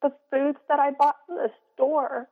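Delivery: high, tight voice after crying; teary. Real, not acted. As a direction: speak on through held-back tears. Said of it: "sorry, I'm getting a little teary".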